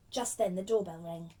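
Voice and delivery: ordinary delivery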